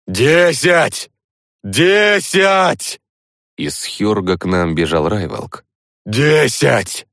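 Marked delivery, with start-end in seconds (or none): 0.1-3.0 high, loud called count; shouting. A narrator's reading. Hunter running, calling a count. 6.1-7.1 shouting. A narrator's reading. Hunter still calling out.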